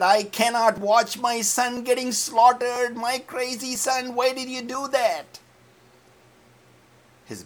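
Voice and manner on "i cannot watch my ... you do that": high, loud anguished cry; father Jesse lamenting his son; anguished wail. Acted. Jesse cried his heart out.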